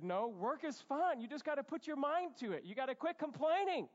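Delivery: insistent dad. Retold or imitated.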